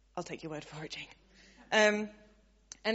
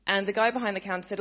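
ordinary talk

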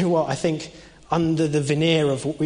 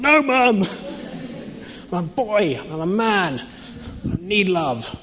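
put-on whiny protesting boy; 4 semitones higher, slower than usual. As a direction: play the sulky boy refusing, pitched up. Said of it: "I was like".

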